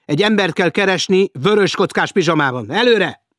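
loud, high commanding shout; officer barking out search orders. A narrator's reading. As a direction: send the men off with a shout. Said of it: "kiáltotta Elder".

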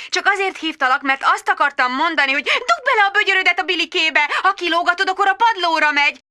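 high, sing-song instructing voice; harried mother. Acted. Magda talking to her toddler, high and sing-song. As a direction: coach a toddler brightly.

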